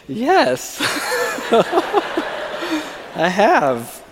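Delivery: giddy yes broken by a laugh. Retold or imitated. Telling her he has considered dating, laughing giddily. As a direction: laugh through the answer.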